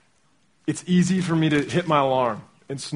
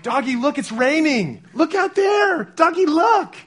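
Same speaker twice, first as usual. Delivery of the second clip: put-on much higher, excited toddler voice; two-year-old son; 12 semitones higher, 5 dB louder than usual. Abel telling his stuffed dog to watch the rain, high and excited. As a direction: squeal like a little boy at the window. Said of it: "He's like".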